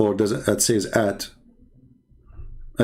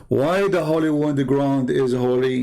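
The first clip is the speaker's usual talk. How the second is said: ordinary talk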